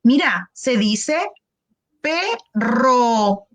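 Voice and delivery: slow, careful "perro"; slow clear model. Shown as a demonstration. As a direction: model "perro" slowly for the child. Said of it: "Entonces yo le digo".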